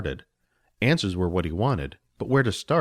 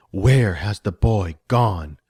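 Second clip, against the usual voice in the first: louder, slow, menacing witch voice; demanding sorceress; 5 dB louder, much slower than usual. Acted. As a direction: demand an answer from the goblin. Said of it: "she demanded".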